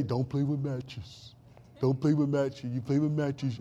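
low, soft cartoon bear voice; Smokey the Bear. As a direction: imitate a bear's slow, low safety warning. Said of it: "that's how you talk".

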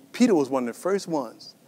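ordinary talk

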